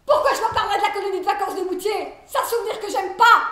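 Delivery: high, shouted angry questioning; very loud, outraged tirade; furious indignation. Acted. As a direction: rage at their prying. Rages at doctors' knowledge of her.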